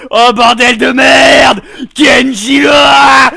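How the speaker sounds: loud, slow outraged yell; exasperated shout. Real, not acted. Host shouting at Kenji after a botched take. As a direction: blow up at the interruption. Yelling at interruption.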